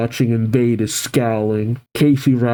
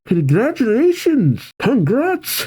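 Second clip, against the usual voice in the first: high, excited congratulations; gleeful, pitched-up cheer; calling out; 10 semitones higher than usual. Acted. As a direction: call it out brightly to a friend. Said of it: "Casey calls out".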